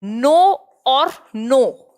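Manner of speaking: drawn-out, spaced sounding of each no; slow teacher demo of a homophone pair; slow word demo. Shown as a demonstration. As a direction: say both words very slowly for the class. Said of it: "जानना और नहीं".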